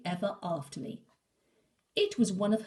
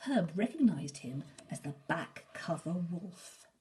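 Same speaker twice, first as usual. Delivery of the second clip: plain reading voice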